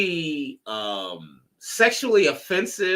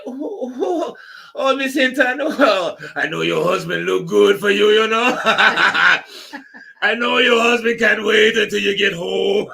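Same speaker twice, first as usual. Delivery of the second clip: higher, louder mock Jamaican charmer with laughter; Jamaican flirting; 5 semitones higher, 6 dB louder than usual. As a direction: sweet-talk her in a laughing Jamaican accent. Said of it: "They're like".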